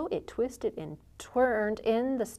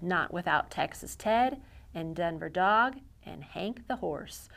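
ordinary delivery, nothing marked

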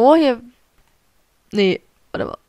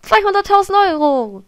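high, loud cheer over winnings; excited; 7 semitones higher, 4 dB louder than usual. Real, not acted. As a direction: cheer it out, thrilled. Jubelt über Spielgewinn. (hoch und laut jubelnd)

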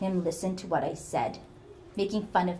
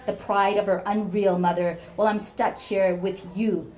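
the cruel mother tearing her daughter down; venomous rant; 3 semitones higher, 8 dB louder than usual. Acted.

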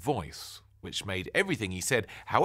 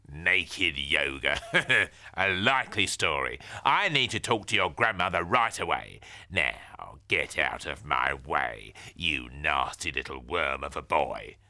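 scoff, then order him aside; sneering neighbour; 4 semitones lower, 4 dB louder than usual. Acted. Nosy neighbour sneering at the boy, low and loud.

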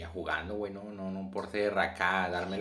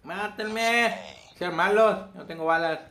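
loud, high panicked pleading; panicking gamer; 9 semitones higher, 7 dB louder, slower than usual. Real, not acted. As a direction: yell helplessly for them to kill you. Out of ammo, begging death.

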